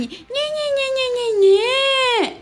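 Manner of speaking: high nasal-free mock whining, taunting; mocking whine. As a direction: squeak it out like a bully mocking someone. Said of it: "Aí ele começou a fazer assim".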